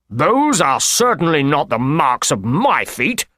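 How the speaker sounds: slow, indignant character voice; agitated Blessington. A narrator's reading. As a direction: protest with mild indignation, drawing it out. Agitated Blessington disputes the footprints.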